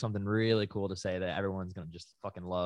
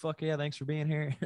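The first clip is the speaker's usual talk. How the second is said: high, hammed-up frontman thanks; mock stage banter; 8 semitones higher than usual. Friend mocking a singer's filler banter, high and hammed-up. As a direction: lampoon a bored singer thanking the crowd.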